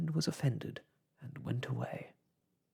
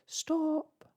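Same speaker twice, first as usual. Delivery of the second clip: crowd shout. A narrator's reading. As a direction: yell it as a whole crowd.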